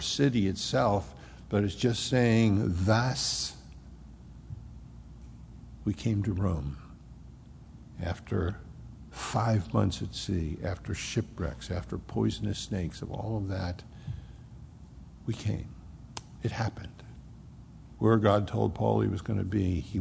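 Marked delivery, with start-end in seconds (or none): 2.8-6.8 hushed. Acted. Preacher hushes for dramatic effect. 8.0-17.0 hushed reverent climax. Acted. Preacher telling his flock of Paul's arrival, hushed and reverent.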